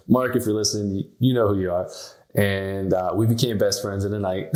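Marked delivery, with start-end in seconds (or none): none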